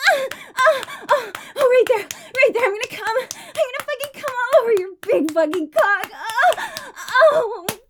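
climax cries. Acted. Streamer performing her climax, crying out high. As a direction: let it peak and shake through the words.

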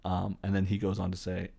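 normal talking voice

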